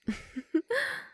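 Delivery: high, airy mocking laugh; amused predatory laugh. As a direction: laugh down at your helpless prey. Vampire mocks captive's refusal.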